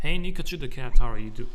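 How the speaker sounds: loud, cheerful calling to the cat; loud pet greeting. Real, not acted. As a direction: call out to the cat loudly.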